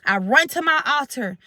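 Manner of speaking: ordinary delivery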